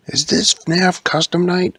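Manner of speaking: high, loud character question; calling out. Acted. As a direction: call it out to the workers. Character calls out to workers.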